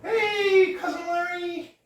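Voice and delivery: high-pitched frog puppet chatter; squeaky puppet greeting his cousin. Acted. Puppeteer voices Kermit to host, high puppet voice.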